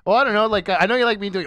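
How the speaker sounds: ordinary talk, nothing marked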